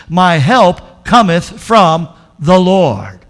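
slow and loud, weighty reading; booming scripture reading; proclaiming scripture. Shown as a demonstration. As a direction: proclaim it slowly to the church. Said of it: "reads verse".

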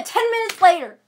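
usual speaking voice

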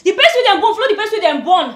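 fast, loud, high tirade; shouting at children. Acted. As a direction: rant at full volume, piling up the threat. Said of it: "Why are you shouting at them?".

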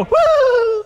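high, loud "woo" of fleeing soldiers; long, high comic cry; mock scared whoop. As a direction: let out a high-pitched whoop. Soldiers' reaction to taunting giant.

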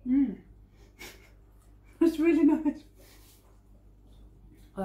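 talking while chewing; muffled by food; mouth full chewing. Real, not acted. Seen 1: Ate sticky piece just before; jaw working, covering mouth while talking.

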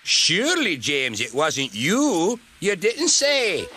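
slow, high, teasing character voice; mock surprise. A narrator's reading. Douglas teasing James about tar wagons, mock surprise. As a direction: draw it out in feigned disbelief.

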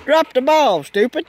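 loud, high yell at the dog; shouting at dog. Real, not acted. Yelling at dog.

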